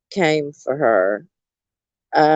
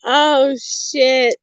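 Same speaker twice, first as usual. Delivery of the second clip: laughing; 9 semitones higher than usual. Real, not acted.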